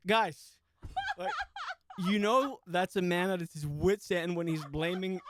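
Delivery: high, loud fed-up plea to nobody; a man at his wits' end addressing "guys"; exasperated customer. Retold or imitated. Man at his wits' end yelling to the whole restaurant, exasperated. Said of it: "He's like".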